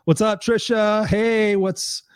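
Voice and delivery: ordinary talk, nothing marked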